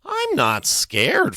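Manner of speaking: high, loud, drawn-out protest; high-pitched defiant boy; boyish bravado. A narrator's reading. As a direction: insist it bravely in a high kid voice. Freak boasts, voiced in story.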